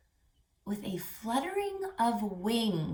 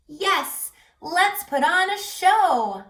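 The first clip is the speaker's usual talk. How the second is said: excited cheer; 8 semitones higher, 9 dB louder, slower than usual. Acted. Animals cheer to perform.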